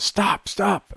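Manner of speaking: high, loud yell of stop. Acted. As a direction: shout it to halt the gunfire. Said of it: "you hear yelling".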